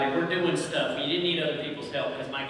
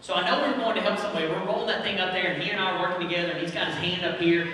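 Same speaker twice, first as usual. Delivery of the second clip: usual speaking voice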